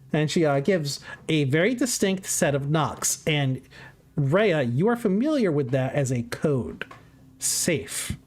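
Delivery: ordinary delivery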